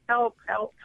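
ordinary delivery

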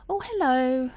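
chirpy receptionist hello; phone voice. Retold or imitated. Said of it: "the pitch might go a bit higher if I'm on the phone".

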